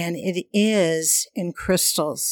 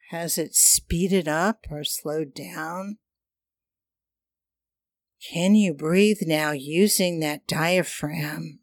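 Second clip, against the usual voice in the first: airy breathy mystical healer. Acted. Leads chakra breathing meditation.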